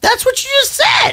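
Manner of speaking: high-pitched exasperated protest; exasperated disbelief. Real, not acted. Exasperated objection in accent argument.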